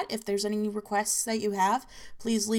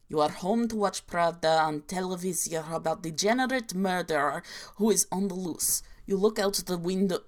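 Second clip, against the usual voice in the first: hammed-up inaccurate Russian accent; a deliberately bad Russian accent, played for laughs. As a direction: read it like a comic Soviet narrator with a bad Russian accent. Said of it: "such a bad, uh, Russian accent".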